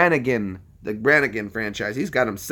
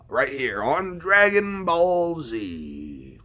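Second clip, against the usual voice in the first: dramatic cartoon preview narrator; episode announcer; 4 dB quieter than usual. Streamer previews the next Dragon Ball Z episode, dramatic announcer.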